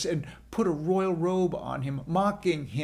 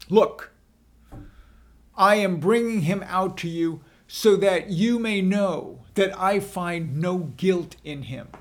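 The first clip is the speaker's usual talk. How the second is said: raised voice to the crowd; governor addressing crowd; 3 semitones higher, 6 dB louder than usual. A narrator's reading. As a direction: call out over the crowd.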